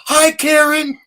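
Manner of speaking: high, loud mocking greeting; mocking call-out. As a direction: holler it across the line to needle her. Loudly calling out to strangers.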